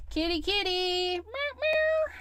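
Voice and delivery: high squeaky meows; playful kitty call. Pretend. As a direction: call the cat, then meow like one. Playing with cat stamp.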